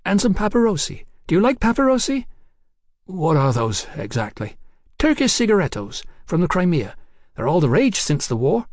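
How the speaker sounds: put-on high, giddy voice of a tipsy young woman. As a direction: play her high and cheeky. Hetty voiced by narrator.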